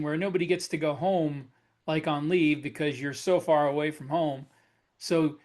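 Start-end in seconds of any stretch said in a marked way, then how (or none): none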